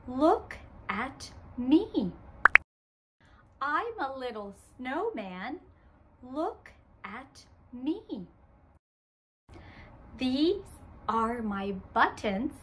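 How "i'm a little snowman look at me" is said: put-on softer, leaving room for the kids; quieter. Shown as a demonstration. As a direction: speak softly so children join in. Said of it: "let's say it together".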